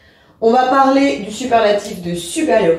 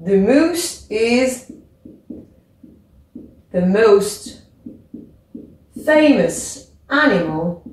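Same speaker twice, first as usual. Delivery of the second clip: slow model; slower than usual. Shown as a demonstration. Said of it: "teacher models full sentence".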